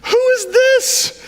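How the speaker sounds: slow astonished Jesus voice, way up high; amazed Jesus. Acted. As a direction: drag it out like you can't believe her faith. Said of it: "he says".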